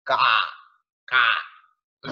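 imitated crow caw. Shown as a demonstration. Teacher showing animal sounds, crow caw. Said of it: "the animal sounds like".

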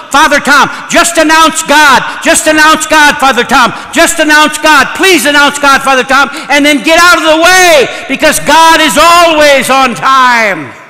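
high, shouted church plea; loud exhortation. Retold or imitated. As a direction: shout it like an urgent parishioner urging on the preacher. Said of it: "she says to me loudly".

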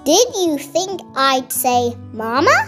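plain reading voice

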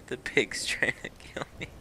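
soft, voiceless words squeezed through laughter; wheezing with laughter; helpless laughing. Real, not acted. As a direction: barely get it out for laughing. Helpless laughter at pig attack.

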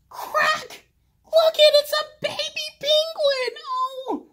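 thrilled high cries over a hatching chick; excited squeals. Acted. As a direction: gasp and squeal as the egg cracks.

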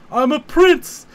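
a hint of Rocky's triumph; lightly voiced boxer bravado; Rocky-style triumph. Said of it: "Lone Star goes".

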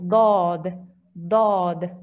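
"Dad" with a heavy, dark, open-mouth d; thick emphatic dad. Shown as a demonstration. Teacher drilling the special Arabic letter dad. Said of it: "the language of Dad".

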